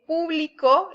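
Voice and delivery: público stressed PÚ-bli-co; PÚ-bli-co, weight at the front; stress on first syllable. Shown as a demonstration. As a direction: lean on the ú of público. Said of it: "en la U".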